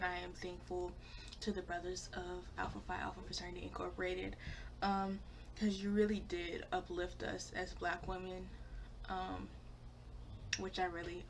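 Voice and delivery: ordinary delivery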